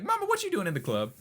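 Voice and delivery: high, fast incredulous son; son's reaction. Retold or imitated. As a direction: ask it in high fast disbelief. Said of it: "he's like".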